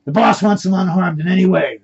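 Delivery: loud, forceful warning; screaming. Acted. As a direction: yell it as a firm order. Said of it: "abductor screaming".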